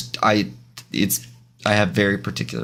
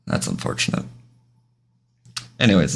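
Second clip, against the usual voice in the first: ordinary talk, nothing marked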